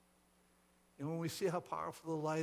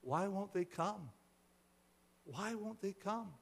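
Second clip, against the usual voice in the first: high, gentle questioning; puzzled plea; 6 semitones higher than usual. A narrator's reading. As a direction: ask in pained bewilderment, higher each time. Woman pleads with stranger, puzzled.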